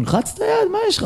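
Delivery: high pleading voice; pleading call. Retold or imitated. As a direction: beg the friend to shake hands. Pleading with ignoring friend.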